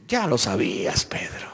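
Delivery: hushed, breathy whisper to Peter; gentle whisper. Acted. Jesus looking at Peter after the denial, tender whisper. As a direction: whisper it like a secret forgiveness.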